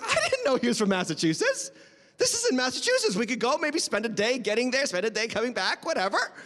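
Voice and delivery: high-pitched, hammed-up amazement; acted excited surprise. Reader finding the place is in Massachusetts, acted excitement. Acts out growing excitement while reading.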